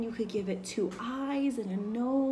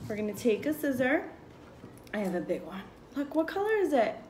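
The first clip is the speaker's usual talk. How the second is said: ordinary talk, nothing marked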